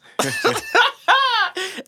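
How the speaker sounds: loud cackling laugh, high; high wheezing laugh; high burst of laughter. Real, not acted. Big laugh after story.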